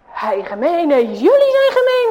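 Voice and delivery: red-faced with rage; hammed-up furious kid; furious bully. A narrator's reading. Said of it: "Huip wordt rood van kwaadheid".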